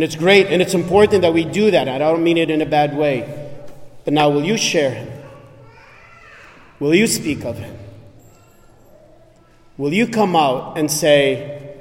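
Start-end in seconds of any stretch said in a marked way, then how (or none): none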